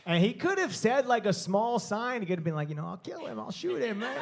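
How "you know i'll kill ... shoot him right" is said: whisper. Retold or imitated. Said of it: "didn't, like, whisper".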